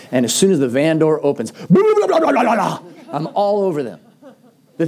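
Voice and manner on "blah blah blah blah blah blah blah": mock yelling rant. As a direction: rattle off the blahs like a scolding dad. Retells yelling at his kids.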